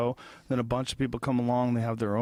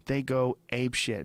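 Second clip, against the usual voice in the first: normal talking voice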